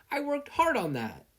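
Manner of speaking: high, indignant mom scolding; wounded pride over her cooking. Acted.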